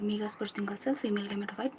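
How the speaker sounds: soft unvoiced recital of notes; quiet study whisper; whispered revision. Real, not acted. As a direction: whisper it to yourself while studying. Murmured rote revision, others near.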